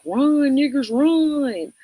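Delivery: slow taunting chant of the bullies; a hint of a mocking taunt. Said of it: "be like".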